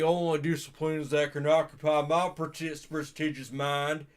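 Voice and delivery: lightly done Southern gourmet; low Southern voice. Acted. Gourmet detective orders the servant in a light Southern accent. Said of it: "low Southern voice".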